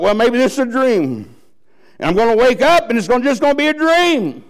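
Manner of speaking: high, loud, fast pleading hope; clinging to the idea it's a dream, rising high; desperate damned soul. Acted. Soul in hell hoping it's dream.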